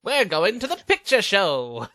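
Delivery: hammed-up announcement with dropped R; old-timey moviegoer. Joking about old words for movies, hammed-up old-timey voice.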